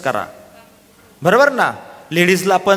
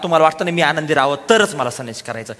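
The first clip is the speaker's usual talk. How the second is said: usual speaking voice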